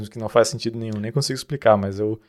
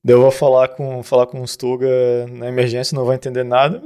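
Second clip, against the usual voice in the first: normal talking voice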